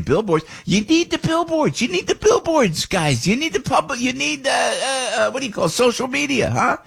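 very high insistent mock of the boss; imitating Stacy. Host imitating Stacy demanding billboards, very high and insistent. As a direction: hammer the demand like a nagging boss.

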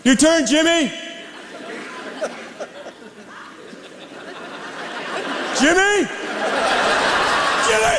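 put-on high, rising shout calling a kid. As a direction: yell across the yard for Jimmy.

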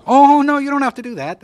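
high, loud startled no; alarmed protest. Acted. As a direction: jump in quickly to stop him. Teen panics at being driven.

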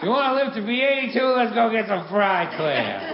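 worked-up dad impression; scoffing dad. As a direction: imitate a father brushing off all caution. Dad scoffs at health advice.

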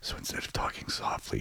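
soft, unvoiced whisper. Shown as a demonstration. Explaining Sarge's attempt to whisper, whispered. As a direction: drop into a hushed whisper.

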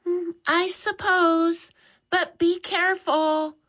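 mother granting permission with a warning; Mom's voice. A narrator's reading. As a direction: give a careful mother's reluctant okay. Mom answers from the living room.

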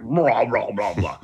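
growly mock stomach rumble; imitated stomach grumble. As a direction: imitate a huge rumbling belly. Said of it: "my stomach just grumbled".

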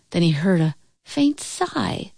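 ordinary delivery, nothing marked

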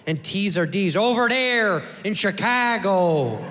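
put-on Chicago accent with Ts turned to Ds; thick Chicago accent. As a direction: exaggerate a slow Chicago accent. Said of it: "with that thick Chicago accent".

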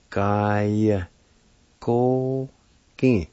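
"Gaya, ko, ki" in long separate syllables. Shown as a demonstration. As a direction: sound out "Gaya, ko, ki" with pauses between. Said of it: "with the G A like this".

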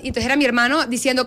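ordinary talk

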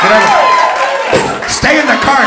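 put-on barked orders at the kid; ordering him into the car; mother shouting at son; mock yell.

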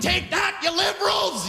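high, shouted cartoon taunt; mock Albertan taunt. Imagined Albertans taunting liberals, high mock shout. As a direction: yell it up high like a gleeful villain. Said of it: "They weren't sitting there like".

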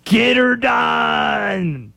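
high, long-drawn Southern holler; Larry the Cable Guy's Southern accent. Acted. Host mimics foreigners' idea of Americans, hollered Southern accent. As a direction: shout it in a hammed-up Southern twang, stretched out. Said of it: "Do Europeans know Larry the Cable Guy?".

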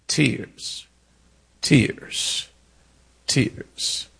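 soft, falling single words with pauses; hushed refrain. Real, not acted. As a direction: let each word drop away quietly. Hushed refrain after the litany.

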